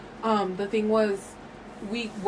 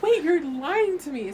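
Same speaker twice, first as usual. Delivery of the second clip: incredulous; 7 semitones higher, slower than usual. Retold or imitated. Retelling her disbelief at the breakup.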